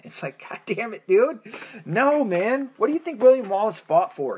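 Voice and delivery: venting real frustration at the listener; fed-up God damn it, dude; exasperated outburst. Real, not acted. Exasperated rant about Scotland's secession.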